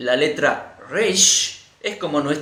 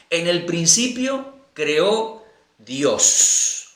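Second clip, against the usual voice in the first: slow savoring; much slower than usual. Shown as a demonstration. Savoring the verse after reading it.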